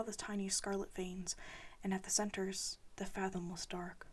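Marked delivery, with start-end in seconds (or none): none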